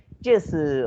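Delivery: lightly imitated Taiwanese accent. Shown as a demonstration. Teacher imitating a Taiwanese student accent on "就是". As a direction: clip the filler the Taiwanese way. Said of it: "there is a Taiwanese accent like".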